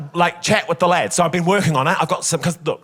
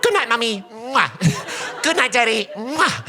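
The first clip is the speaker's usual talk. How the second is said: put-on higher, childlike goodnight with kisses; sweet little boy; 6 semitones higher, slower than usual. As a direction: say it like a small boy blowing kisses. Retold child saying goodnight.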